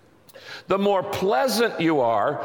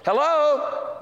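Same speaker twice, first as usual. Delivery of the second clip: high hello, falling at the end; high-pitched hello; 11 semitones higher than usual. Shown as a demonstration. Preacher showing ways to say hello, high-pitched greeting. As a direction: sing out hello way up high, then drop. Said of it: "think of all the ways you can say hello".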